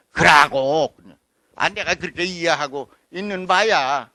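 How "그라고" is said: «그라고» with an open «a», firm; dialect pronunciation. Shown as a demonstration. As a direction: correct it back to «그라고». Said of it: "그리고가 아니야".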